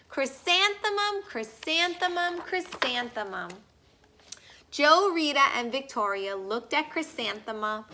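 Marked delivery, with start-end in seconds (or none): 0.1-3.5 high, bright repeats falling to rest; the girl blooms and repeats her own name happily; joyful name. A narrator's reading. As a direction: say her name glowing with pride.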